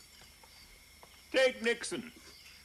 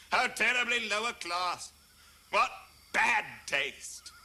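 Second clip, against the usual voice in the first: mock snobbish sniff; 9 semitones higher than usual. Mocking snobs about Bob Hope.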